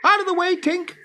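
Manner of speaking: put-on boy yelling at the fairy; shouting boy. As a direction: shout her out of the way. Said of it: "he shouted".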